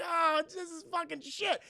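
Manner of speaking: high, slow, drawn-out complaint; hammed-up whine while hanging; mock despairing wail. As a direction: wail it in comic misery. Voices dangling man's despair.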